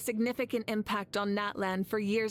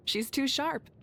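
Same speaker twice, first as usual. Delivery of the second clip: ordinary talk, nothing marked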